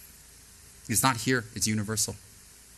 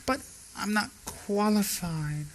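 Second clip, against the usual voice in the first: fake humility; 8 semitones higher, 6 dB quieter, slower than usual. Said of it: "fake pretentious humility".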